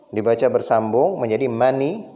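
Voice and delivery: ordinary talk, nothing marked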